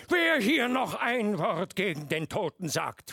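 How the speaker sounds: high, loud menacing warning; angry threat. A narrator's reading. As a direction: threaten them outright. Lieutenant threatens drunk officers.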